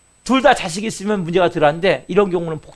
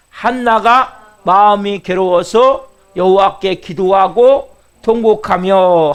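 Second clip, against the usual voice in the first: scripture reading; 5 dB louder, much slower than usual. Acted. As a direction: draw the verse out slowly and fully. Scripture read over music.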